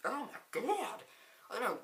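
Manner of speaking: high-pitched, exasperated outburst; exasperated disbelief. Real, not acted. Reviewer dismayed at A J being picked, exasperated. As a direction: throw it out in disbelief.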